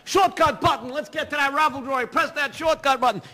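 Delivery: high, loud eager push; urging the crew to press the button; excited urging. Real, not acted. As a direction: hurry them along eagerly. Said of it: "urging raffle".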